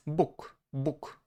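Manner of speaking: "buk" ending in a voiceless k; final devoicing. Shown as a demonstration. Teacher giving the Polish for god, devoiced ending. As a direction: say "buk" as book, crisp k. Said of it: "we have to use the voiceless counterpart".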